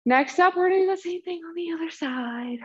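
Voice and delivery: breathless, airy whisper; panting between words; out of breath. Real, not acted. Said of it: "so out of breath right now".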